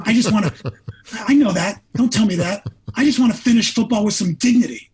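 pushing back at the wife's comfort, half laughing; frustrated protest. Retold or imitated. Frustrated reply to his wife.

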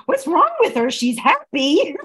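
high, loud mock alarm; mock disbelief. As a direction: act scandalised by someone's happiness, drawing out the end. Acts out suspicion of happy person.

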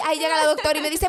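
ordinary talk, nothing marked

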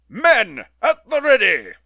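loud, high rallying call; calling orders. Acted. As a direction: summon his soldiers with a grand cry. Villain summons soldiers.

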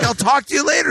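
high-pitched mock enthusiasm; retold starstruck sign-off; gushing, pitch way up; giddy goodbye.

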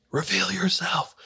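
mock scream. Pastors at the exorcism, screaming at a demon, high and restrained. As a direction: imitate a scream up high but held back. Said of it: "as they scream".

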